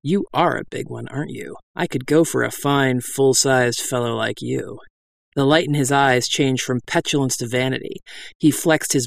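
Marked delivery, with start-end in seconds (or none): 0.0-4.8 honeyed lower register. Acted. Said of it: "dropping my voice into a honeyed lower register".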